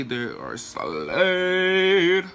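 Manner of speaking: slow, drawn-out brag about her brows; sassy slow boast; proud show-off. Real, not acted. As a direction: stretch it out, pleased with yourself. Showing off finished brows.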